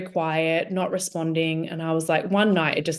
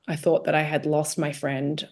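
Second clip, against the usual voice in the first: usual speaking voice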